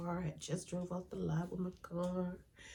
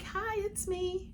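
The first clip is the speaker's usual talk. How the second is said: much higher, slow and sing-song hello; eager high greeting; 13 semitones higher, 3 dB louder, slower than usual. Retold or imitated. Answering the hospital call thinking it is good news, high and eager. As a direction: answer the phone all bright and hopeful. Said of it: "I'm like".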